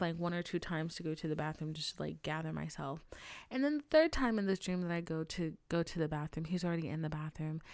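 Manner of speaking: usual speaking voice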